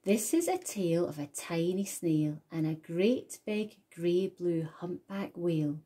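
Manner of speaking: plain reading voice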